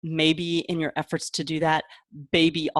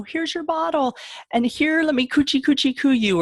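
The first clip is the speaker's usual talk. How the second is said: put-on high, sing-song cooing to a baby; mock-doting parent cooing "coochie coochie coo"; baby talk; 9 semitones higher, 4 dB louder than usual. Parent baby-talking to a child.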